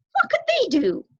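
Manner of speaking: high, fast, fretful bird voice; anxious young crow. Acted. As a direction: hurry like a nervous youngster. Crow asks.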